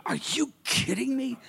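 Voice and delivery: quiet disbelieving scoff; indignant older brother. Retold or imitated. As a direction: scoff under the breath.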